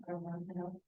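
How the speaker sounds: normal talking voice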